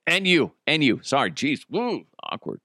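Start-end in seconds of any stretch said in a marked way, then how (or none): none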